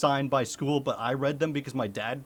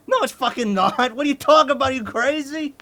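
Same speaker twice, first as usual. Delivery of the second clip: much higher and louder, incredulous outrage; outraged; 10 semitones higher, 8 dB louder than usual. Real, not acted. Answering the teacher's excuse with loud outrage. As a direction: blast the excuse as absurd.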